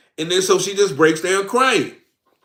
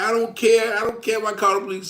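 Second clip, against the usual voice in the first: mock defiant girlfriend; 7 semitones higher, faster than usual. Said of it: "she's starting an attitude".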